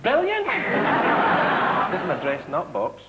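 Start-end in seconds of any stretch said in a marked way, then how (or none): 0.0-2.0 gushing. Retold or imitated. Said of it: "She'd go".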